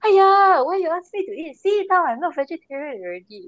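high complaining auntie, Singlish. Retold or imitated. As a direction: whine like a fussy auntie. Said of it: "then she'll be like".

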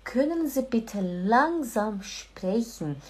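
slow, careful German sentence; slow, polite German. Shown as a demonstration. Teacher models the polite request slowly and carefully. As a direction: spell out every word slowly. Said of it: "Aí você fala".

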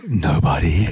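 a low, creepy "Nobody"; creepy reply. Retold or imitated. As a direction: say it like a creepster on the phone. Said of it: "I turned into the total creepster".

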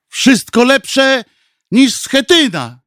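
high, drawn-out groaned line; unconvinced moan. A narrator's reading. Party member asked to volunteer, unconvinced slow moan. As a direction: moan it slowly, only half convinced. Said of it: "Jęknął bez przekonania".

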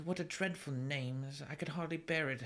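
lightly mocked theatrical girl, a touch high; mock the heroine's flowery diction; dramatic Anne. Imitating Anne from the movie, lightly theatrical. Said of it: "she's all talking like".

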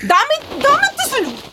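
angry demand. Acted. Grabbing partner's phone in fight.

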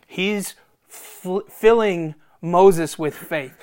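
ordinary talk, nothing marked

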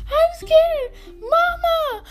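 high, whiny imitation of a terrified friend; mock scared wail. Storyteller mimics high schooler crying for her mom, mock scared wail. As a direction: cry for Mama in an exaggerated high voice. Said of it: "the baby talk".

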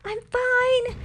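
very high, loud reply; yelling back. A narrator's reading. Nakoma reassuring Pocahontas from the river, yelling. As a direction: call back at the top of your range. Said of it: "Nakoma yelled back".

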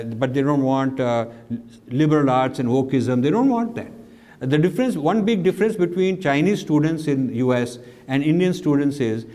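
ordinary delivery

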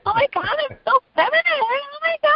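very high, fast, airy mock feminine; hammed-up valley girl gushing; mock girly girl. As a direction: overdo a prissy girly voice. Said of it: "they all high pitched".

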